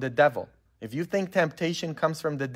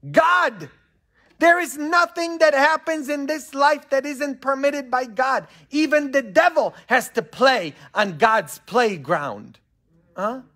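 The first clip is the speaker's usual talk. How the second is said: high-pitched, forceful pulpit proclamation; fervent preaching; 10 semitones higher, 4 dB louder than usual. Acted. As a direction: proclaim it with full conviction.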